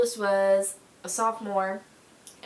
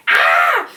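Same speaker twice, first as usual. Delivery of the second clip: retold scream of pain; 16 dB louder than usual. Tire runs over her arm.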